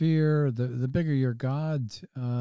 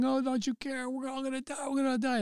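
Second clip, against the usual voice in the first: high, mock-panicked cries; hammed-up panic from frightened disciples; panicky disciples; 12 semitones higher, 6 dB quieter, faster than usual. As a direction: wail it like terrified sailors in a storm. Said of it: "they're all yelling".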